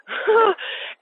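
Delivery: short, high laugh. Real, not acted. Guest recalling match dates at night, short laugh. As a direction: let out one high laugh. Laughs amid her grim story.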